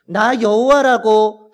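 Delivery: slow, loud, high proclamation; God's declaration. Retold or imitated. God declaring his name to Moses, slow loud proclamation. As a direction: proclaim it with full authority. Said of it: "하나님이 모세에게 말하고 있는 것입니다".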